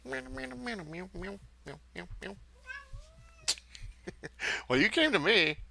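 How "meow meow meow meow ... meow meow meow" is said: squeaky kitten meows to the cat; playful meowing. Pretend. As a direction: meow back at the cat like a kitten.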